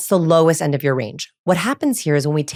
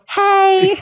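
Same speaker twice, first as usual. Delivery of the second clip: Hey very high and louder; squeaky nervous hey; 14 semitones higher, 5 dB louder than usual. Shown as a demonstration. Coach shows the nervous greeting she avoided, squeaky high. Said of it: "I didn't go".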